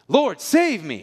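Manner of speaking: loud, high cry for help; yelling. Retold or imitated. Peter sinking in the waves yells to Jesus. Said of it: "the Bible says he yells".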